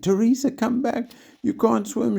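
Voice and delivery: very high, loud frantic cry; frantic scream. A narrator's reading. Kelly screaming after her troll doll, high and frantic. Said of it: "she sounded frantic".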